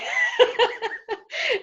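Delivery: loud, high burst of laughter; real high-pitched laugh. Real, not acted. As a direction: burst out laughing at your own story. Laughing at her own story.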